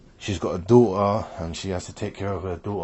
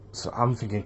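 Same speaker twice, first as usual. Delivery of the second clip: usual speaking voice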